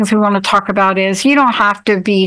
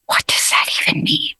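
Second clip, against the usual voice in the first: whispered confusion; 5 dB quieter than usual. Retold or imitated. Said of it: "And you're like".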